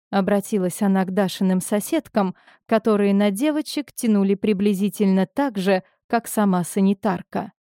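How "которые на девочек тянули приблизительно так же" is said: ordinary delivery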